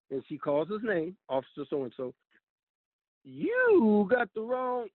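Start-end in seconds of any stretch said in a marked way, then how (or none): 3.2-4.9 drawn-out, raised-pitch protest; louder, higher retold objection; mock indignation. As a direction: imitate a lady scolding, stretching the first word. Lady at jail scolds the officer.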